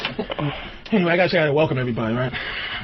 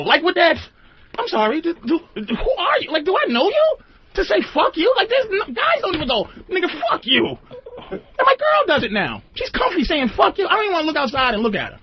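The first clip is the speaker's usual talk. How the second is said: high-pitched, incredulous rant; worked-up, high-pitched comic exasperation; exasperated; 10 semitones higher than usual. Real, not acted. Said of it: "the exasperation in my voice".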